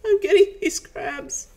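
hammed-up cramping agony; strained, pained voice. A narrator's reading. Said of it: "his voice training".

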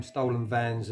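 ordinary talk

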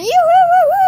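very high, loud victory whoop; high cheering yodel at the finish; excited whoop. Pretend. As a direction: whoop like you just won. Celebrating a win.

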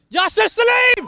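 name shouted high and loud; excited yell. Real, not acted. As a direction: belt the name out as he runs at goal. Name yelled as he breaks.

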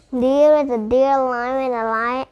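plain reading voice

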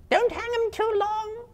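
a greedy spider gloating over its catch. Acted. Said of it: "said a third".

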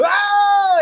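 high, loud mock crowd shout; sudden burst of imitated crowd noise; imitated crowd roar. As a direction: yell out like a startled crowd. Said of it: "people are like".

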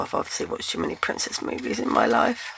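ordinary delivery

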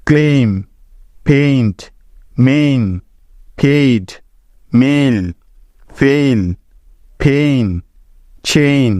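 রেইন and চেইন said with a clear drawn-out "ei" vowel; long a sound demo. Shown as a demonstration. Teacher listing example words for students, careful long "ei" vowel. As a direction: pronounce each word slowly, stretching the "ei" of মেইন. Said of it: "এর sound সবসময় এই হয়ে যায়".

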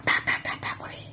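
unvoiced whispered ta ta ta. Mother whispers baby sounds to her toddler. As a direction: tap out a secret in a whisper. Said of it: "কনি কনি কথা বলবো".

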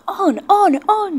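high, loud, wild yell. A narrator's reading. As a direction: urge the runaway on with a shout. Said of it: "yelled the trucks".

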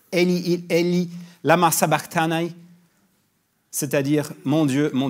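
a loud anguished cry from the cross. Acted. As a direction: call it out loud, full voice. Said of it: "s'écria d'une voix forte".

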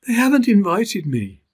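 high, wistful little elephant voice; a lonely little calf looking on; young elephant Bobo. Acted.

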